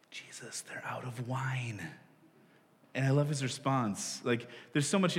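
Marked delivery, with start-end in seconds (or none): 0.1-1.8 hushed whisper to her son; mom whisper. Retold or imitated. Mary whispering to Jesus at the wedding, hushed whisper. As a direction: lean in and whisper like a mom nudging.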